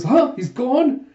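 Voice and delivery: high, loud double-take; startled disbelief. Acted. Huck looks back and finds Jaeha gone, startled disbelief.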